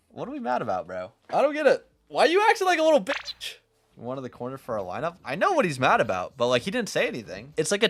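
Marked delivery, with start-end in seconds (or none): 0.1-3.6 high, loud, baffled rant; incredulous outburst. Real, not acted. Coach reacting to teammate raging at Phoenix, incredulous outburst. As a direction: blurt it out, unable to believe it.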